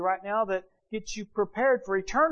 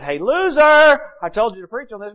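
mock rebuke; 6 semitones higher, 11 dB louder than usual. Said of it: "he could have said".

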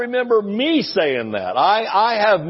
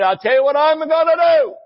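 put-on higher, louder old-time preacher voice; raised, emphatic folksy preacher; Brother Hagin impression; 9 semitones higher, 4 dB louder than usual. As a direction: answer the devil the way Brother Hagin would. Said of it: "Sound like Brother Hagin".

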